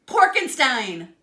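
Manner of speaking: slow, loud horrified reveal; horrified gasp. A narrator's reading. Inventor pig realising his creation is a monster, horrified. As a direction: drag out Porkenstein in horror. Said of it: "Doctor Pig gasped".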